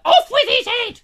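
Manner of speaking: shrieked high royal command; screaming order of execution; very loud, high mock queen yell; Queen Victoria shouting. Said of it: "I'm going into Queen Victoria".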